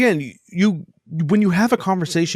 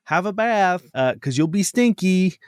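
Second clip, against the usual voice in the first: put-on higher, sing-song voice for a small child; talking to a kid; 6 semitones higher than usual. Host explaining chores to a child in a higher, gentle voice. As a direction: coax it like you're telling a kid to bathe. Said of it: "you kinda do that with kids".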